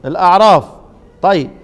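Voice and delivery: usual speaking voice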